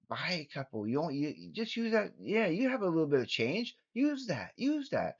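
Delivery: soft, urging voice of temptation; coaxing inner voice. Pretend. Lottery tickets calling to the vlogger, softly coaxing. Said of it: "it was calling to me. It was, it was saying".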